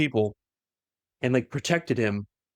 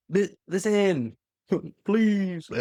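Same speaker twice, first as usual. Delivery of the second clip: higher, slow, hammed-up effort; straining plea; 6 semitones higher, much slower than usual. Wounded son begging his father to hear him, strained plea. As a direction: act out pleading through great strain. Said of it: "he's, like, pushing himself so hard".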